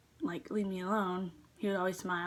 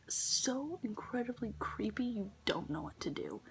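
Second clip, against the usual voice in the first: creepy whisper; 5 dB quieter, slower than usual. Real, not acted. Describing creepy fast food customers.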